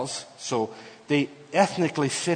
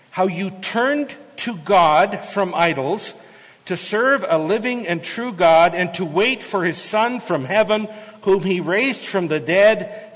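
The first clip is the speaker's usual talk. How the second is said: scripture reading; 4 semitones higher, 4 dB louder than usual. Acted. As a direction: proclaim the verse from the pulpit. Reads scripture aloud with force.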